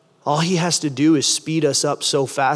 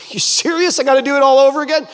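high, louder, fast disbelief; incredulous protest; 10 semitones higher, 5 dB louder, faster than usual. Acted. Man balks at being told to do it again, incredulous protest. Incredulous reply to a repeated command.

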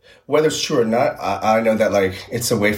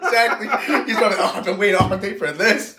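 laughing; 13 semitones higher than usual. Real, not acted. Voicing spirit while laughing.

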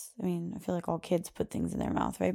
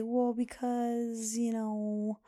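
put-on slow, drawn-out, higher kid voice; child thinking up excuse; 4 semitones higher, much slower than usual. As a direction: stall like a small child searching for a reason. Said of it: "she'll kind of try to think of something".

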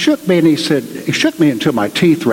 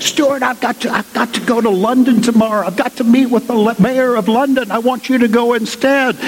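higher, rushed boss voice; hurried rector; 7 semitones higher than usual. Retold or imitated. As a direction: dump the job on him, pitched up and quick. Rector offloading a London trip.

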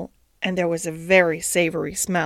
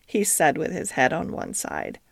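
usual speaking voice

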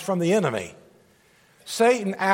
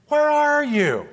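loud searching call, higher; 8 semitones higher, 4 dB louder, slower than usual. A narrator's reading. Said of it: "the Lord God called to the man".

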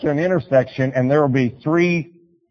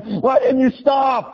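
very high, drawn-out mock yell; upset yelling; 12 semitones higher, slower than usual. Missionary to the driver who ran a stop sign, mock yelling. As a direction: imitate a rattled passenger scolding the driver. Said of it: "yells at me".

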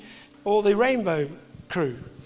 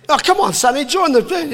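teasing coax; 5 semitones higher, 5 dB louder than usual. Retold or imitated. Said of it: "mates teasing each other".

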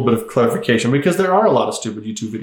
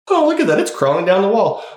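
high-pitched mock wonder, easing down; acted delight; 6 semitones higher than usual. Onlooker watching sticky toy crawl down wall, acted delight. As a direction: marvel like an amused onlooker. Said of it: "You see that, and it's like".

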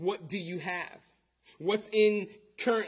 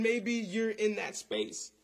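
usual speaking voice

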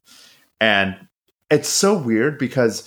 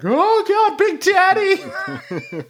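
very high, drawn-out hammed-up fright; mock scared shriek; much slower than usual. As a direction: shriek as if a monster appeared. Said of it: "my towel dropped and I was like".

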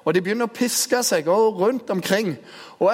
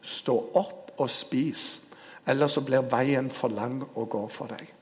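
solemn key line; 3 semitones lower, 5 dB quieter than usual. Shown as a demonstration. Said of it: "hemmeligheten ligger i midten her".

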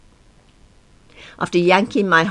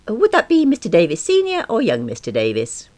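higher receptionist voice; 9 semitones higher, 4 dB louder than usual. A narrator's reading. Receptionist asks caller.

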